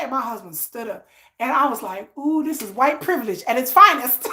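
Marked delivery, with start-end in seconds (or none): 2.2-4.3 real laugh bursting out, a louder cry; laughing shout. Real, not acted. Telling chat about the restaurant fight, laughing loudly. Reacting to husband standing up.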